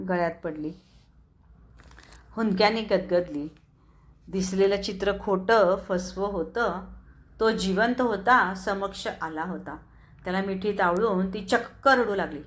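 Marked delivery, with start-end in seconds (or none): none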